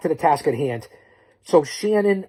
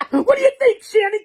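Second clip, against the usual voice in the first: high call-out; 6 dB louder than usual. Real, not acted. Calling out to Shannon on camera.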